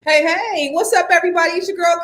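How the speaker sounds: ordinary talk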